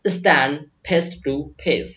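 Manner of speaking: very slow, clear teacher model; slow classroom repetition; slow dictation. Shown as a demonstration. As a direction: spell it out slowly for students to copy. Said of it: "मैं आपसे कह रहा हूं".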